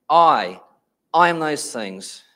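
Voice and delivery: loud, weighty "I", then a pause before "I am those things"; "I" hit hard and repeated in "I am those things"; emphatic I. Retold or imitated. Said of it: "They spoke with emphasis".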